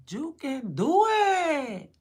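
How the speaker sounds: high, drawn-out shout of encouragement; loud cheer. Retold or imitated. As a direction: cheer him on at full voice. Reenacting cheering on his son.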